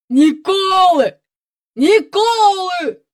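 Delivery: slow, high drawn-out echo; high-pitched, stretched-out echoing cry; echoing boys. Acted. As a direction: chant it back like taunting boys. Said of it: "Луною озвалися Вільям з Еверардом".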